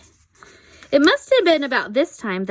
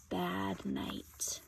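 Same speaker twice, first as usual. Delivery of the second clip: soft hushed whisper; 15 dB quieter than usual. Real, not acted. Parent whispering to the child while showing a picture. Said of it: "whispering at the story's end".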